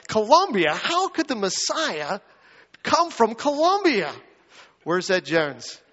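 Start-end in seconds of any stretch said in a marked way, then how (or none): 0.1-4.1 rising, louder, unbelieving Colombia?; scoffing disbelief. Pretend. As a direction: scoff as if it's absurd. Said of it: "You'd be like".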